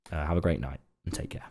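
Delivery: late-night hushed farewell; whispered goodbye. Real, not acted. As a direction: whisper the sign-off, winding down for bed. Sleepy late-night sign-off.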